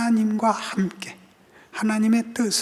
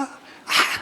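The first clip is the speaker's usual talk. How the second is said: usual speaking voice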